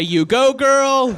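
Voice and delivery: imagined hype from the snow cone guy, sassy; mock sassy cheer. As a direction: hype her up like a best friend.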